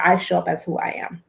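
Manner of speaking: ordinary talk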